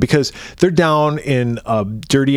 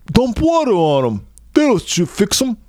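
higher mock voice for Duke; pitched-up imitation of a dumb movie hero; imitating Duke; 9 semitones higher than usual. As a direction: throw the line up high like a goofy hero. Duke's line voiced in retelling.

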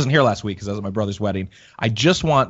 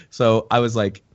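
usual speaking voice

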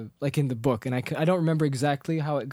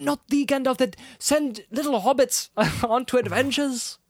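high excited Bilbo; 9 semitones higher than usual. Retold or imitated. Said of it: "Bilbo's just like".

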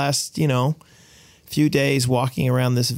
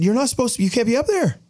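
alarmed scolding; 11 semitones higher, faster than usual. Retold or imitated. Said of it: "he said".

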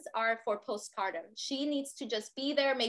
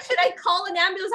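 panicked; 7 semitones higher, 8 dB louder, faster than usual. Retold or imitated. Panicked husband.

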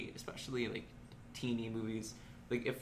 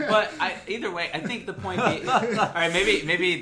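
laughing through the words, louder and higher; 6 semitones higher, 10 dB louder than usual. Real, not acted. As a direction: giggle through an embarrassed correction. Laughs at own gaffe.

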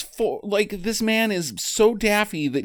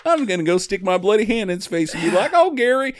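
mock trainer teasing lion; 8 semitones higher than usual. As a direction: coo at the lion like a shy pet. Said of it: "and he just goes".

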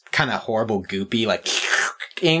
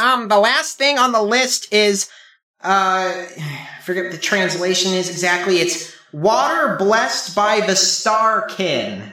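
higher helper voice, slowing on the answer; higher-pitched sidekick voice, unhurried; Limden character voice; 8 semitones higher than usual. Acted. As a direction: answer as the fussy guide, thinking it over.

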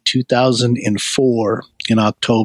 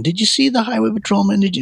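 a higher, half-mocking yell of panic; mock scream; 10 semitones higher, faster than usual. Girlfriend panicking at the patrolman, mock yell. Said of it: "She's screaming".

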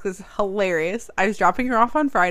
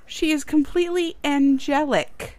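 quoted teacher; 6 semitones higher, slower than usual. Retold or imitated. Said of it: "I'm quoting".

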